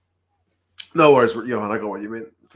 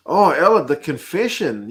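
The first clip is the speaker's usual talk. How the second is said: higher and louder, perking up at a name; excited call-out; 5 semitones higher, 6 dB louder than usual. Real, not acted. Streamer spotting a viewer's comment, excited. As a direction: call out to her with a jolt of glee.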